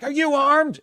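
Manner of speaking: high called question across a gap; calling out. Retold or imitated. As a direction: shout the question to strangers. Strangers calling across bridge.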